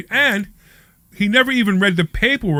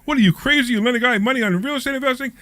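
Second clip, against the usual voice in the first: mock alarmed friend; 8 semitones higher than usual. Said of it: "spooked by somebody saying".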